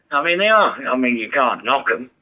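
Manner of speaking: ordinary delivery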